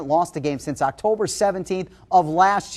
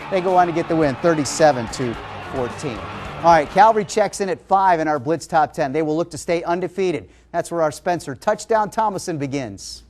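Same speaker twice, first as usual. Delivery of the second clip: ordinary talk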